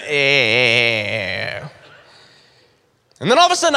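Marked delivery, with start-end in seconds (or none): 0.0-1.6 low, eerie grunting eh; imitated creepy noise. Boy hears a spooky noise in his dark room, a low eerie croak. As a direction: croak it like a lurking demon. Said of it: "but I hear him".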